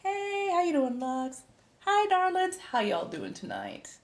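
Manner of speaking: high sing-song hello; affectionate greeting. Real, not acted. Streamer greeting arriving viewers, high affectionate lilt. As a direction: coo a hello, pitched well up.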